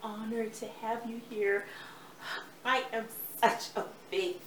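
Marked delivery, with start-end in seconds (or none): 0.0-1.6 whispered in awe; starstruck whisper. Real, not acted. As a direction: breathe it like a fan. Starstruck director greets star.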